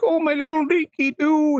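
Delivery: high, squeaky mock old lady; silly old lady voice. Mocking the game's voice acting, squeaky old lady coo. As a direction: mimic a badly acted old woman cooing over her pet. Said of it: "who did they pay to do the voice".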